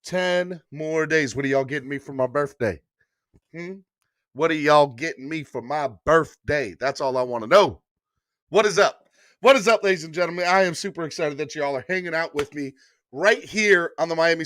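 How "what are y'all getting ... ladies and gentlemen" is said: usual speaking voice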